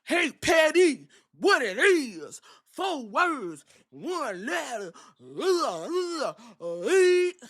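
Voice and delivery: high, slow, hammed-up hype voice; Jay impression. Jay taunting his opponent in a high, slow hype voice. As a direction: drag it out like a cocky wrestler. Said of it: "Because Jay said".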